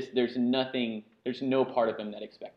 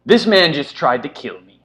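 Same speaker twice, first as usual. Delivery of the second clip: loud, outraged guard cry; booming indignant guard; guard's declaration; 5 semitones higher, 13 dB louder than usual. Acted. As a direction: call it out loud and higher for all to hear. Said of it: "he kinda declares to no one in particular".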